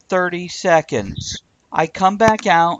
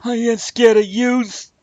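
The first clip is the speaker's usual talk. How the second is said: tough guy voice; 7 semitones higher, slower than usual. Acted. Voiced lizard taunting.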